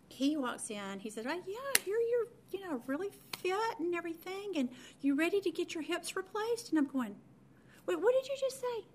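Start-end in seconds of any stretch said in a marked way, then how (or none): 1.3-6.7 breezy doctor. Retold or imitated. Main doctor's breezy hip announcement. 7.9-8.8 high-pitched startled question; stunned disbelief. Retold or imitated. Patient hearing she needs hips replaced, high stunned disbelief. Reacts to hip replacement news.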